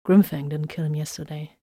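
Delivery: ordinary delivery, nothing marked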